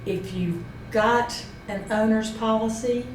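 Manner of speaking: usual speaking voice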